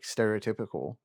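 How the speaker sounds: normal talking voice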